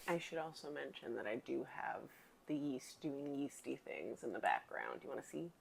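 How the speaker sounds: whispered aside. Real, not acted. Whispering to viewers about the yeast in the background.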